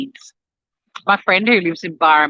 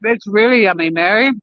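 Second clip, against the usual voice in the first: talking while crunching toast; crunching while eating; 3 semitones higher than usual. Real, not acted. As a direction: mouth full, crunching. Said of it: "Can you hear me crunching?".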